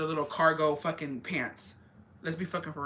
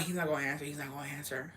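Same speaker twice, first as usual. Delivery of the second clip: much softer muttered repetition; hushed hoping; 4 semitones lower, 9 dB quieter, faster than usual. Retold or imitated. Waiting in the office, hushed hope he won't answer. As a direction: say it under your breath to yourself.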